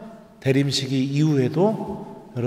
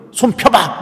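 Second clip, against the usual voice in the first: higher, louder retold command to open the hand; mother's sharp order; 7 semitones higher, 5 dB louder, slower than usual. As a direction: snap it like an angry mom catching a thief. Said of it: "잡더니 이러는 거예요". (화난 엄마의 높고 큰 호통)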